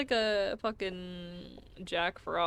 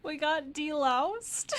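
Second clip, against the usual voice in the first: surprised; 7 semitones higher, 4 dB louder, slower than usual. Real, not acted. Surprised at game event.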